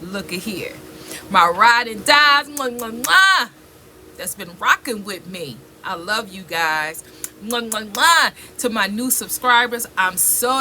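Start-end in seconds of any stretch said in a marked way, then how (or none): 2.5-3.5 blowing kisses. Real, not acted. 7.4-8.3 blowing kisses. Real, not acted.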